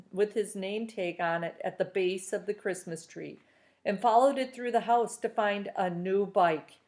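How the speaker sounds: plain reading voice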